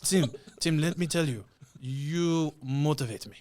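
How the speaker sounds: imitated French accent, slowing on the last words. Quoting what the DJ said to him, mock French accent. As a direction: put on a thick French accent, drawing out the ending. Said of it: "in his French accent".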